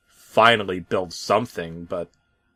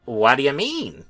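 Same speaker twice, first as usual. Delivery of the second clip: truck asking; 5 semitones higher than usual. Acted. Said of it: "one of the trucks said".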